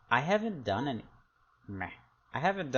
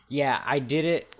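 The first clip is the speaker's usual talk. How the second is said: excited; 7 semitones higher, 11 dB louder than usual. Real, not acted. Triumphant after setting price.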